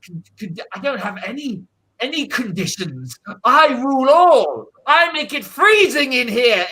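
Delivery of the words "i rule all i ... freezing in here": louder, higher grandiose boast; megalomaniac rant. Acted. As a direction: declare total power, a bit hammed. Sorcerer boasts of his power.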